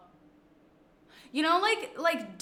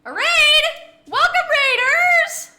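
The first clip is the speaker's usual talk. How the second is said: excited; 11 dB louder, slower than usual. Real, not acted. Sudden raid, excited greeting.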